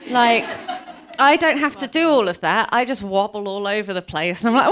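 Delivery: usual speaking voice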